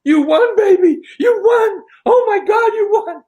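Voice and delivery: high, loud, thrilled shouting; his date shrieking with joy as he wins a Grammy; excited squeal. Retold or imitated. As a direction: squeal it like she can't believe he won. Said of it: "She says".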